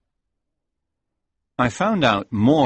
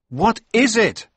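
cry out; 13 semitones higher, 5 dB louder, slower than usual. Acted. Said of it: "I cried".

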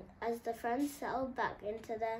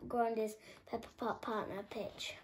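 ordinary delivery

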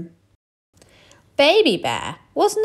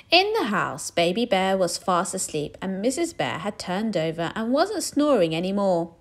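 ordinary delivery, nothing marked

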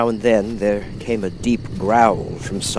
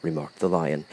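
plain reading voice

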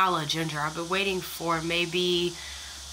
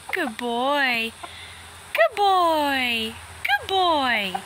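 higher praise for a good dog; praising a pet; 7 semitones higher, 4 dB louder, much slower than usual. Real, not acted. Praising dog after it comes.

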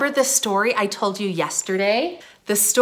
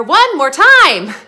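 loud, high, stretched-out call; 8 semitones higher, 10 dB louder, slower than usual. Real, not acted. Teacher cues the children to sing once more, loud high call. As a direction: call for another round, big and high. Calls for repeat of song.

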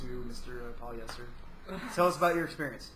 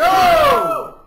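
shouting go; 13 dB louder than usual. Real, not acted. Countdown to a drinking race.

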